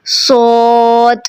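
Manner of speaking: सोद slowed, with air on the final द; wrong, breathy ending. Shown as a demonstration. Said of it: "last में दाल में ना air ले आते हैं".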